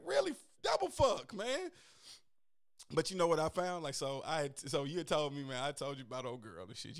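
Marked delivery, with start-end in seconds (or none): 0.1-1.7 high, loud disbelieving outburst; mock outrage. Ghosted by a girl he liked, high mock outrage. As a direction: throw up your hands in mock shock. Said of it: "you'd be like".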